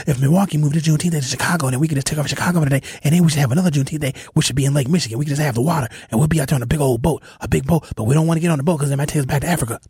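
imitating a caller who talks real fast; rapid-fire caller. Host mimicking a regular caller who rushes every word. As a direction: rattle it off breathlessly fast like the caller. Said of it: "That's how she talks".

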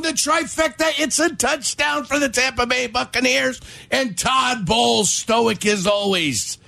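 excited booth call, rising to shouts; play-by-play commentator. Pretend. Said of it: "I'm gonna do the impersonation of Todd Bowles".

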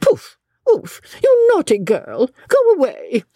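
high, huffy shooing; rather cross. A narrator's reading. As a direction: shoo away a naughty girl. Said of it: "she said rather crossly".

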